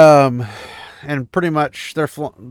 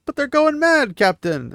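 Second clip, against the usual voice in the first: high, alarmed cry to the captain; panicked yell; 13 semitones higher, 3 dB louder than usual. Retold or imitated. Sailor warning his captain amid explosions, a high alarmed cry.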